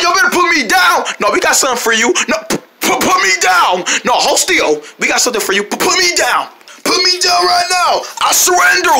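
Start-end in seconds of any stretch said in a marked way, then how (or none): 0.0-1.0 yelling to be put down. Acted. Grabbed by friends, yelling to be put down. Being picked up against his will. 2.3-3.8 high stammer breaking into a shout; stammering yell. Acted. Hoisted by friends, stammering then yelling. Being picked up against his will. 5.6-8.9 panicked yelling. Acted. Carried off by friends, yelling then surrendering.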